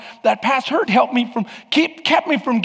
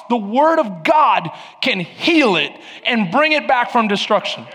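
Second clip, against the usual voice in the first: raised, ringing sermon voice; full-force preaching; 5 semitones higher, 3 dB louder than usual. Acted. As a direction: proclaim it boldly. Preaching rises again.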